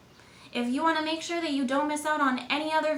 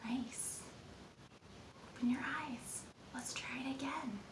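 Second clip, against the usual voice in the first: whisper; 14 dB quieter than usual. Acted. Quiet after listening exercise.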